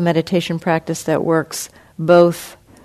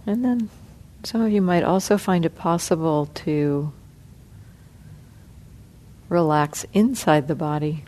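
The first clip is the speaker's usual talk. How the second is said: soft, unhurried meditation guidance with long pauses; guided meditation; 10 dB quieter than usual. Acted. Teacher guiding the sitting group through body relaxation, soft and calm. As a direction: ease the group gently through each part of the body.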